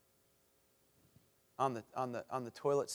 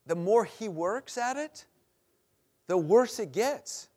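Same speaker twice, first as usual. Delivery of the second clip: slowed, higher and louder for weight; slow emphasis; 6 semitones higher, 7 dB louder, slower than usual. Acted. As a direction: lean on each word slowly. Preacher building on son's plight.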